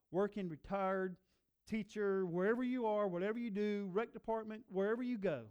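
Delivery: fired-up sermon build; emphatic preaching. Real, not acted. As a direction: drive each item at the room. Preacher listing, building intensity.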